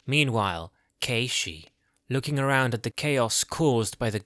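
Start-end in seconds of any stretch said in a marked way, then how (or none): none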